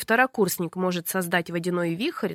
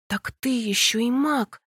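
plain reading voice

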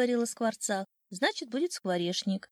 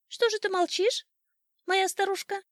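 high, insistent bird voice; pestering old sparrow; 11 semitones higher, 4 dB louder than usual. A narrator's reading. Old sparrow pestering his silent wife, high chirping. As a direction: nag up high like a little sparrow. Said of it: "приставал к ней старый воробей".

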